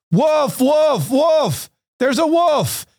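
very high-pitched mock shout of alarm; mock cry for help. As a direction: cry wolf in drawn-out high calls. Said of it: "the boy had cried wolf".

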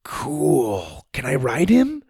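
softly drawn-out wonder; soft awe lifting into a hopeful question; awed boy. A narrator's reading. Boy asking to ride the elephant, softly awed.

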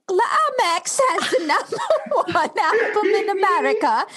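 high, badly faked British accent; Jessie's accent, badly done. Co-host takes a turn at Jessie, bad high British accent. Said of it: "That was my Jessie".

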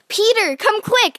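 high, loud excited call; shout for Peter. A narrator's reading. Girl calls her brother to see, high and loud. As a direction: call your brother over fast. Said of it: "I shouted".

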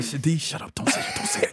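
urgent inner voice. Retold or imitated. Husband warning himself before answering his wife, urgent hushed inner voice. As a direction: whisper it frantically to yourself.

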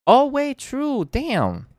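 high, loud "oh wait" of realizing; sudden realization. Real, not acted. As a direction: squeak it out as the mistake dawns on you. Realizes he was wrong.

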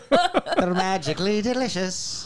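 high, slow leprechaun slogan; hammed-up Irish cereal mascot; mock Irish accent.

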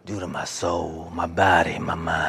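low, slow seductive croon; Romeo voice. Pretend. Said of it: "this is Romeo right here".

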